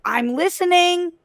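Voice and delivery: a mock doubting audience member, drawn out; sceptical challenge. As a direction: fans daring the actress to prove herself, sceptical. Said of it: "everybody was like".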